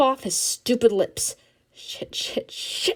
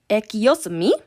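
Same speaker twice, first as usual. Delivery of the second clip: a mock Spanish-accented question, a bit louder; imitating Ma's Spanish accent; 5 dB louder than usual. Teen to school caller, faking her mother's Spanish accent. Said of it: "trying to imitate Ma's accent".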